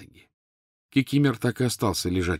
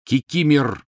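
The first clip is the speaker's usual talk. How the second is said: loud, higher fierce shout of the name; 6 semitones higher, 5 dB louder, slower than usual. A narrator's reading. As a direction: bark the elf's name in fury. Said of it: "свирепо произнёс Гарри". (свирепый громкий окрик)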